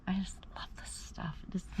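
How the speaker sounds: soft secret whisper; whispered aside. Real, not acted. To viewers outside the tow company, a delighted whisper. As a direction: breathe it like a guilty pleasure. Aside while filming on location.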